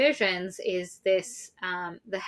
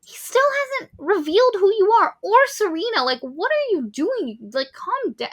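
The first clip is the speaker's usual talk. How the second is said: exasperated; 12 semitones higher, 4 dB louder than usual. Retold or imitated. Speaker's exasperated retort to Lainey.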